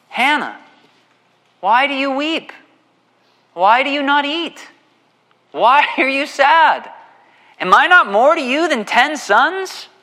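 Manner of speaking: high, loud, breezy husband's voice; a cheerful, oblivious husband asking why she weeps; clueless husband. Retold or imitated. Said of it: "Look at what he says in verse eight".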